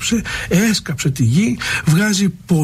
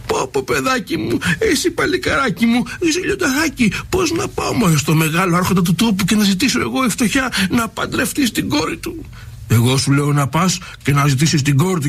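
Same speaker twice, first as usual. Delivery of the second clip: put-on high, fussing old-woman voice, much higher; fretting old woman; 4 semitones higher than usual. Old woman protests to her lion son, high fretful voice. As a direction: wring your hands in a high old granny voice.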